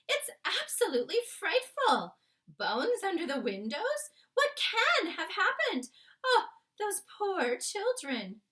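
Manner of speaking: high, loud distressed exclamations; the queen aghast at the headlines; horrified queen. Acted. Queen horrified reading paper.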